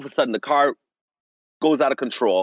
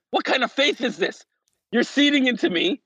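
amazed; 6 semitones higher than usual. Retold or imitated. Amazed at parents' faith.

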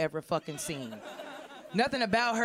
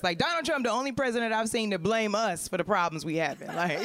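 usual speaking voice

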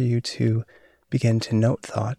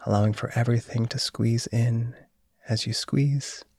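guided meditation. Acted. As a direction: guide them into calm and sleep. Leads a body relaxation.

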